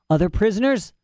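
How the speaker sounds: put-on high, fussy old scholar voice; eccentric old professor. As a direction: play a proud, rambling old professor. Professor echoes Lyra.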